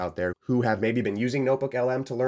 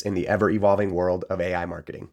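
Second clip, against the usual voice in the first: podcast intro; 5 dB louder than usual. Acted. Podcast show opening.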